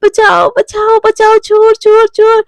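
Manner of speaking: high, loud panicked cries for help; screaming for help. Acted. Woman screaming for help in the night, loud and high. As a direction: scream it out so the house wakes. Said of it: "चिल्लाने लगती है".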